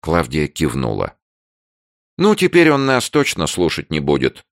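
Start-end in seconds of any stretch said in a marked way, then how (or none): 2.2-4.4 high, firm confident statement. A narrator's reading. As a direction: say it with certainty. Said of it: "уверенно сказала Таня".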